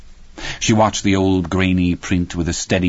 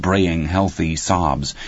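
plain reading voice

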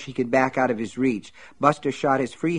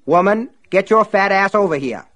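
angry command; 9 semitones higher, 7 dB louder than usual. Acted. Buster orders Myrtle to him.

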